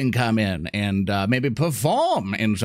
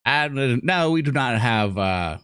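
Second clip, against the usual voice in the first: ordinary talk, nothing marked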